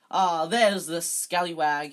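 loud, drawn-out greeting; hearty welcome. A narrator's reading. Lockhart greeting Harry at his door, hearty welcome. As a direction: hail him loudly and expansively.

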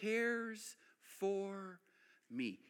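slow, soft, amazed; awed hush. Retold or imitated. Peter hauling fish, softly amazed at God's care. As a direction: murmur it in quiet wonder. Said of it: "he's going".

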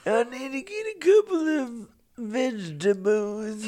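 put-on slow, high old-timer; put-on elderly voice; old man voice. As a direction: creak along like an old man. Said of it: "I very much like my old man voice".

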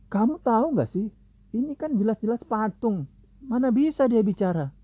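high, scornful angry challenge; angry crowd retort. Acted. Townsfolk mocking Ibrahim's answer, high scornful anger.

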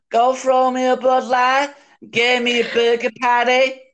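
British speaker faking American; attempted American accent. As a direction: put on a bad American accent, Southern-sounding. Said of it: "Can you talk American?".

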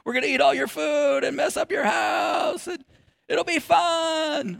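high, mock-cheerful shouting guests; cheery houseguests. Pastor mimics uninvited guests bursting in, high mock cheer. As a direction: ham up the pushy visitors.

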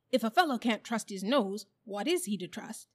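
loud, emphatic protest; indignant bulldog. A narrator's reading. Bulldog defends trusting his nose, indignant. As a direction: argue it with offended force.

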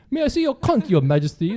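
high-pitched pub Brit impression; mock British accent. Host imagines overhearing pub talk, high British accent. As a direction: leap up into fake British. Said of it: "so you think British people".

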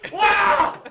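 high, loud shout of shock; surprised shout. Pretend. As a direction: yelp in sudden surprise. Acted victim's surprise.

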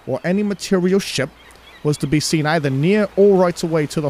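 amateur British accent reading. Shown as a demonstration. Co-host reading a captain's log on request, attempted British accent. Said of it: "one out of ten on my British accent".

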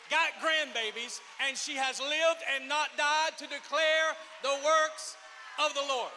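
loud, slow preacher's shout; preaching at full force. Acted. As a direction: build it up and shout the declaration.

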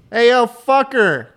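loud, slow, high New York yell; hailing a taxi. Shown as a demonstration. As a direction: bellow it out to flag down a cab. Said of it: "Try to hail a cab".

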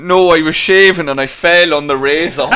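loud, laughing retold excuse; can barely get the words out for laughing; laughing through quote. Quoting her sister's razor excuse, loud and laughing.

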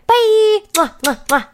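between kisses. Real, not acted. Goodbye kisses.

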